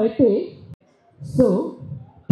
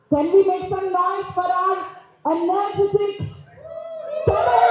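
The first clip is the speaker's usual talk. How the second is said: hype announcer; 9 semitones higher, much slower than usual. Real, not acted. Anchor hypes crowd for actor.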